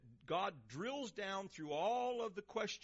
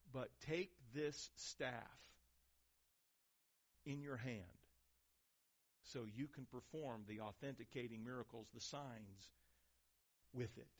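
quiet God voice; 6 semitones lower, 6 dB quieter than usual. Acted. God telling Moses to take the staff, hushed. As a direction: hand it over gently, hushed.

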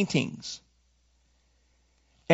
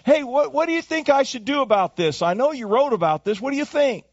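calling up to Paul; 7 semitones higher than usual. Pretend. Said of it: "I'll shout up to you and say".

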